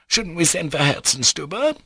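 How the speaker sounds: high-pitched, flustered suggestion; anxious mother's cry. Acted. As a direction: cry it out as a worried mamma. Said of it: "cried Madame Holikov".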